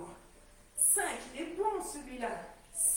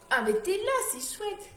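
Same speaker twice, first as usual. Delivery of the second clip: raised cheerful hello; loud greeting; 6 semitones higher, 7 dB louder than usual. Real, not acted. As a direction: call out brightly over the wind. Greets Geneviève, wind noise.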